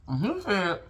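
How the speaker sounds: ordinary talk, nothing marked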